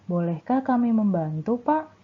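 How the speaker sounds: Pak lifted high at the end; rising question tune. Shown as a demonstration. Teacher showing pupils a rising question tune. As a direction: let the question lilt up. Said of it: "intonasinya sedikit mengalun ya".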